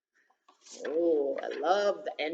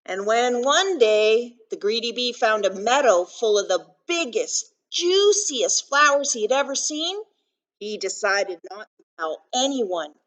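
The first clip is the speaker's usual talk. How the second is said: put-on louder, emphatic storytelling to children; picture book read-aloud; 2 semitones higher, 10 dB louder than usual. A narrator's reading. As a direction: read it out big for little listeners.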